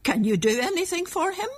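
rather sharp. A narrator's reading. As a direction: press for an answer, a little sharply. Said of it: "said Grandmother at last rather sharply".

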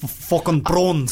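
put-on slow South African accent. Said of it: "South African".

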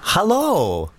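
put-on high-pitched Dutch accent. Guest phoning hotel reception, Dutch accent. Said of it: "you're Dutch".

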